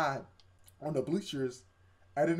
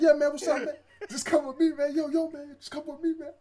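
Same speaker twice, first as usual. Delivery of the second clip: high-pitched put-on man's voice; urgent beckoning stranger; 12 semitones higher, 4 dB louder than usual. Man in lab coat summoning the main character, mock urgent. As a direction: call him over in a raised character voice.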